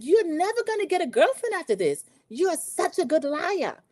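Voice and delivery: high-pitched theatrical scolding; mock wounded accusation. [name] scolding Rob for lying, mock wounded. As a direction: wail the reproach, slowing at the end. Betrayed woman accusing him, acted.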